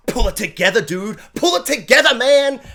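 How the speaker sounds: high, loud, rising exhortation; mock urgent pleading. Reviewer urging a character to pull it together, mock urgent shout.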